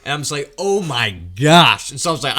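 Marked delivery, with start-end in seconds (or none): 0.6-1.9 slow awed oh my gosh. Retold or imitated. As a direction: stretch it out in disbelief. Awed again at noise.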